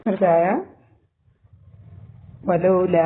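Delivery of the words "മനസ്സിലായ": ordinary talk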